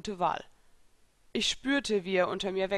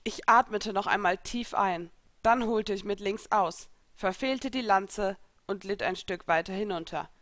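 ordinary delivery, nothing marked

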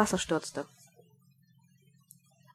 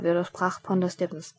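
plain reading voice

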